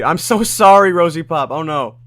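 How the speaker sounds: high, loud, playful dismay; dismayed apology. Real, not acted. Streamer apologising to viewer whose brother started yelling, loud dismay. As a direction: wail the apology, easing off at the end.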